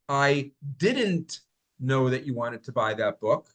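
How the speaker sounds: didn't pushed up and held, rest slow; stress on didn't. Shown as a demonstration. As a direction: punch didn't to show disbelief. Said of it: "listen to how I say these".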